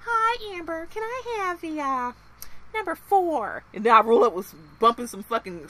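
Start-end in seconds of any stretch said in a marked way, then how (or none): 0.0-3.6 high sweet voice, way up; sweet polite order. Retold or imitated. Greeting the cashier Amber, high mock-sweet voice. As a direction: ask nicely, all sugar.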